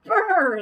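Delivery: shiver the brr out; shivery brr. Acted. Badger shivering from cold.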